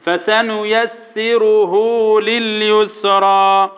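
slow tajweed chant; drawn-out recitation on a sustained tone; light-lettered recitation. Shown as a demonstration. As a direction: intone the verse with light consonants. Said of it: "كلها مرققه".

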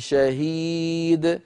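شيء شهيد slowly with the tanween hidden before شهيد; model recitation. Shown as a demonstration. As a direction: hold the nasal hum into شهيد. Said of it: "نفس النطق".

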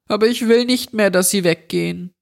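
put-on high, fast, pleading little boy; frightened boy begs the intruder to spare his parents, high and quick; pleading child.

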